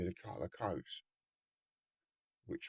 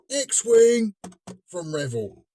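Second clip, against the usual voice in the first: big, loud, high reveal of the name; excited announcement; 12 semitones higher, 13 dB louder, much slower than usual. Acted. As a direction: announce it like a game show prize.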